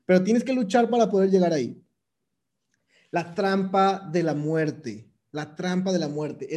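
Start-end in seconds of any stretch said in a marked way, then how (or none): 3.1-6.5 slow ominous. Acted. Naming the dreaded death trap.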